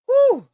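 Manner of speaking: high, loud blown-out whew; exhale whew. Real, not acted. Host reacting to Brianna's past, loud high whew. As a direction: let the air out in disbelief.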